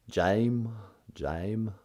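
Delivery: lightly drawled Southern "Jame", like "Jaim". Retold or imitated. Imitating how Southern people say "Jame". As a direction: mimic a Southern "Jame" with a long, open vowel. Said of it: "because of Southern people".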